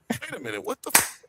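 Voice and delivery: normal talking voice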